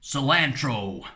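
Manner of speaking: "Cilantro" in a slow mock American accent, sil-AAN-tro with a flat a; drawn-out attempt at saying "Cilantro" the American way. Shown as a demonstration. Vlogger trying a self-admittedly bad American accent. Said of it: "How would I do it in a, an American accent?".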